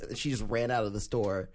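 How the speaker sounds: usual speaking voice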